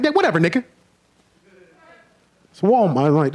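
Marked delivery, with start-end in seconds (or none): 0.0-0.6 higher, lightly voiced brush-off; dismissive buddy. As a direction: wave it away as the friend. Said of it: "He's like".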